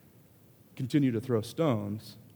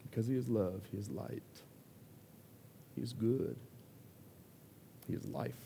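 hushed; 2 semitones lower, 13 dB quieter than usual. Real, not acted. Quiet closing affirmation.

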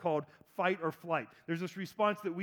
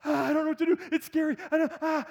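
higher, louder, flustered; mock panic; 9 semitones higher, 5 dB louder, faster than usual. Person fleeing a scary situation, mock panic. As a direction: fluster like someone bolting. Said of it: "run, run, run away".

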